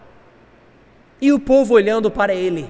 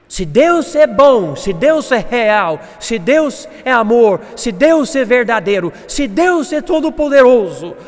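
full-force preaching; 5 semitones higher, 7 dB louder than usual. Real, not acted. Preacher challenging brothers about needless complaining, preaching forcefully. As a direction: hammer each clause, climbing to the question.

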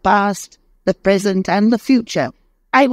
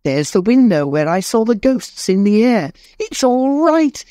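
put-on much higher, giddy joyful old man; overjoyed Scrooge. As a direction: bubble over with joy like a new man. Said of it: "He danced".

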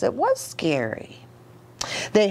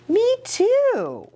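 puppet voice; 14 semitones higher, 3 dB louder than usual. Acted. Puppet answers the reader.

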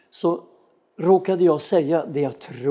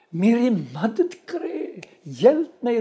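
higher, louder, drawn-out imitated cry for help; återberättat rop på hjälp; 7 semitones higher, 4 dB louder, slower than usual. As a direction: call out as a desperate woman would. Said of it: "hon ropar på hjälp". (återberättat, högt rop på hjälp)

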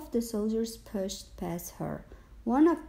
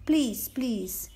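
higher-pitched silent plea; imploring; 4 semitones higher, 4 dB louder than usual. A narrator's reading. Girl silently begging the soldier, imploring. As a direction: plead with mild urgency. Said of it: "she implored in her mind".

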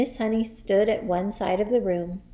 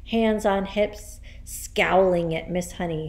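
usual speaking voice

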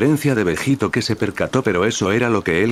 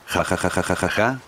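softer, mock villain ha-ha; maniacal laugh; 3 dB quieter than usual. Majin Buu gloats over his energy ball, softer maniacal laugh. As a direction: laugh like a madman, lightly played. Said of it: "dijo de forma maniática".

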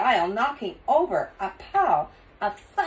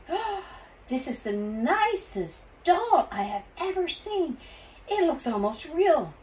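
higher, cooing admiration; admiring lady; 7 semitones higher than usual. A narrator's reading. Store lady admires what she takes for a doll, gushing. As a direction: gush over it with swooping pitch.

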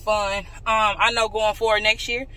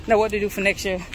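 normal talking voice

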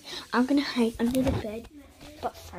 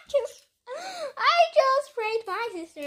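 laughing; 12 semitones higher, 5 dB louder, slower than usual. Real, not acted. Revealing the prank.

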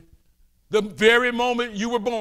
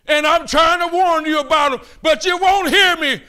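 raised, urgent preaching cry; impassioned preaching; 6 semitones higher, 4 dB louder than usual. Real, not acted. Preacher warns congregation about demons, raised and urgent. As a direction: plead loud and high with the crowd. Preacher pleading at full force.

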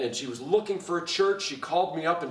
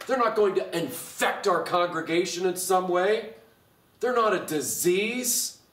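building preaching; 5 semitones higher than usual. Acted. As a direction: build through the list, then stretch the last phrase high. Preaching climax, building list.

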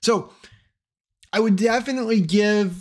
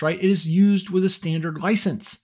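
ordinary delivery

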